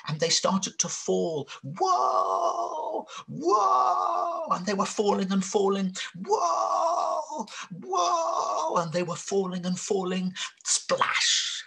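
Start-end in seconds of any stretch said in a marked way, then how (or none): 1.6-4.5 high, long wail while falling; falling cry. Acted. Animals falling off the mountain. 6.2-8.8 high, long wail while falling; falling cry. Acted. Animals still falling. 10.6-11.6 breathy splash noise; splash sound. A narrator's reading. Animals hit the pond.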